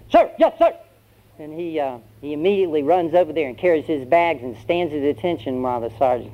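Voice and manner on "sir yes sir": high, barked recruit reply; drilled recruit. Acted. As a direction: snap back an instant answer to the sergeant. Recruit answers by reflex.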